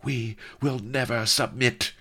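high, heroic refusal; defiant cry. A narrator's reading. Vanity refuses the villain, defiant cry. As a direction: cry it out proudly, pitched high. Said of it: "cried Vanity".

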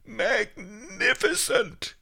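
high, loud, stretched-out cheer; slow, soaring shout of delight; exultant yell. A narrator's reading. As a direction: yell it in awe, drawing out every syllable. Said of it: "Miser Shen and I yelled as one".

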